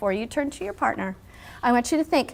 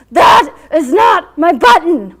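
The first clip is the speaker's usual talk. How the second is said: "That is not my button" wailed much higher and louder, slowly; wailing; 5 semitones higher, 14 dB louder, slower than usual. A narrator's reading. Toad rejecting the raccoon's button, loud slow wail. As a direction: wail it out in despair. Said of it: "wailed Toad".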